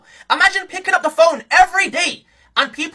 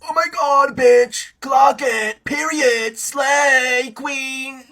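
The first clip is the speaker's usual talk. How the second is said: lower, drawn-out fruity grandpa voice; elderly zesty caricature; 4 semitones lower, much slower than usual. Retold or imitated. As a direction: imitate an old man talking fruity. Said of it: "still using that voice".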